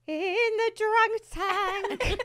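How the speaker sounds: high, slow sung character voice; Debbie. Acted. Duet demo for friends, singing as a high-voiced character. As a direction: sing it high and drawn out in character. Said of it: "And then Debbie go".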